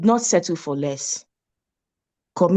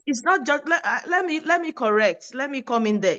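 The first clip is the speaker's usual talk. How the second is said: much higher, urgently cutting in; eager interruption; 6 semitones higher than usual. Real, not acted. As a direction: jump in to correct him, pitch way up. Cuts in to correct host.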